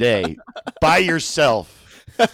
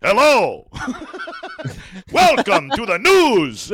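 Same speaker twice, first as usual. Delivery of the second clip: drawn-out, slow, louder anchor greeting breaking into laughs; sultry Spanish news anchor; 6 semitones higher, 3 dB louder, slower than usual. Retold or imitated. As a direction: stretch out the welcome like a sultry TV host. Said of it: "Spanish language news is like".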